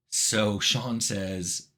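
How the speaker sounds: normal talking voice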